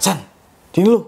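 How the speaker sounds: re-enacted urgent summons; calling out. Calling his friend over, retold.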